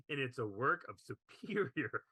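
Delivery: real laughter breaking into the quote; trying to read on through giggles; laughing while reading. Real, not acted. Podcast host reading a glowing film review, cracking up. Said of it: "can't get through that without laughing".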